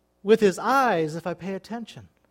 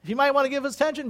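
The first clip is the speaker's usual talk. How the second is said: normal talking voice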